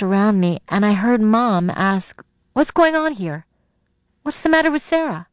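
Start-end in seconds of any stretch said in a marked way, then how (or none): 2.5-5.3 high, worried mother's questions; pitched-up motherly alarm. A narrator's reading. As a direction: demand to know what is wrong. Alarmed mother arrives and asks.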